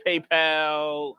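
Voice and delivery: ordinary talk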